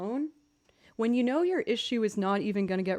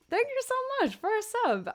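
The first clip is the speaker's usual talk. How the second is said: much higher, gushing thank you; excited thanks; 14 semitones higher than usual. Real, not acted. Streamer thanking a subscriber, excited. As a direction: squeal your thanks.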